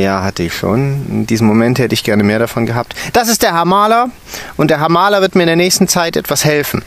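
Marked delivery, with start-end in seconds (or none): 3.1-6.8 a bossy teacher presenting the intern; stern teacher. A narrator's reading. Stern teacher introducing the intern to the children. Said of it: "sprach der Drache mit tiefer Stimme".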